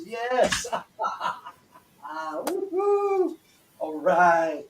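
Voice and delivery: high whoop with laughter; excited celebration. Shown as a demonstration. Coach demonstrates celebrating with the body, excited. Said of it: "fun or celebrating".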